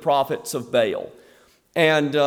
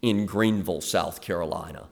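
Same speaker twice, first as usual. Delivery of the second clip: softer, lower, self-pitying whine; mock lonely martyr's prayer, softer and lower; 6 semitones lower, 5 dB quieter than usual. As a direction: pray like you're the last faithful soul, a bit hammed-up. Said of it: "Have you ever prayed to God that way?".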